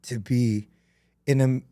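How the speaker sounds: halting, with long pauses; holding back real emotion, groping for words; getting emotional. Real, not acted. Speaker on real loss to the listeners, getting emotional. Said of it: "I don't mean to get emotional here".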